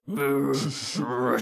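lightly growled animal noise; a short rolling brr of a talking wolf. Acted. Wolf speaking to the party, lightly growling.

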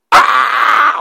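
put-on high, loud scream of a refused toddler; toddler tantrum shriek. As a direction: shriek like a thwarted two-year-old. Child refused cake throws tantrum. (trotsigt småbarnsskrik)

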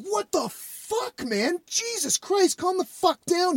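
very high, softened mock yell; acted exasperated protest. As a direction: plead in disbelief, held back in volume. Retold protest to raging man.

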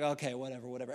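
low, soft brush-off; uninterested, quiet dismissal; dismissive. Acted. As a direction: wave them off, unconvinced. Friends brush off the pleader.

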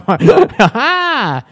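high laugh ringing out; embarrassed laugh. Real, not acted. Host after nearly revealing something about herself, embarrassed laugh. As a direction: burst out laughing, high and drawn out.

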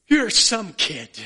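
hammed-up Irish dad praising his son; Irish father's blessing. Irish father hugging his son on the green, slow, loud Irish accent. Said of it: "the father in his Irish accent say".

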